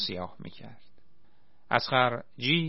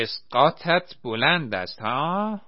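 loud, slow taunting yell; mocking shout; 4 semitones higher, 6 dB louder than usual. A narrator's reading. Old militiaman running to the stage taunting Saddam, loud mocking shout. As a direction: fling the retort out as a challenge. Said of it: "فریاد زد".

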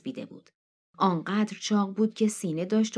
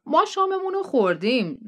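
Matt's friendly voice; 8 semitones higher, 4 dB louder than usual. Acted. Matt answers Neil.

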